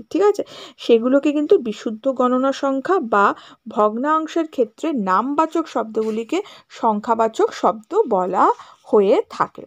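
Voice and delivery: ordinary delivery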